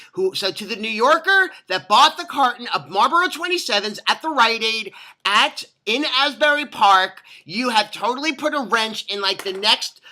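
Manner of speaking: emphatic mock-outraged address; indignant rant. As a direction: call out the culprit with mounting exasperation. Rant addressed to absent New Yorker.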